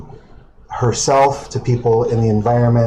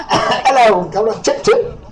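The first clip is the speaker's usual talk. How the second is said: higher mock Cockney greeting, Mary Poppins style; cartoonish fake British accent, pitched up; hammed-up British accent; 9 semitones higher than usual. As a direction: ham up a fake London accent.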